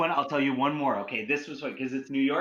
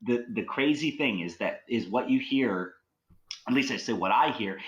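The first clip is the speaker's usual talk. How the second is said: ordinary talk, nothing marked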